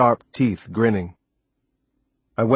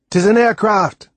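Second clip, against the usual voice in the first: put-on high, louder island-accented cry; excited; 11 semitones higher, 5 dB louder than usual. A narrator's reading. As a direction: announce the discovery excitedly. First hearing of an aircraft.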